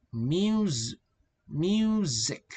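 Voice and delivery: "music" broken up, first syllable stretched; strong first syllable. Shown as a demonstration. Teacher modelling a practice word, long stressed first syllable in "music". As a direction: draw out the MU of "music". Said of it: "Strong syllable".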